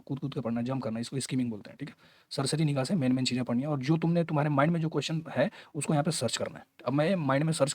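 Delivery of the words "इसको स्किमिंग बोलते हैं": ordinary delivery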